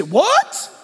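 a hint of a startled, rising "What?"; mock surprise. Congregation member hears it, mildly startled. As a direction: play a listener caught off guard. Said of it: "You say".

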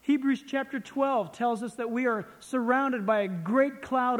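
emphatic sermon delivery, loud and projected; forceful preaching. Real, not acted. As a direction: proclaim it to the whole congregation. Preaches from scripture at full voice.

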